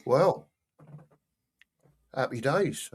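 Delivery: talking while crunching ice; words around a mouthful of ice; chewing ice. Real, not acted. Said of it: "chewing on a bit of ice".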